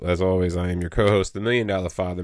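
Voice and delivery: normal talking voice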